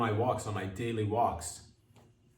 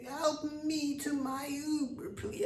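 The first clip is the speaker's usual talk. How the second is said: put-on very high, slow frail old lady voice; 4 dB quieter, slower than usual. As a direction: creak out a tiny old woman's plea. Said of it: "old lady asking for help".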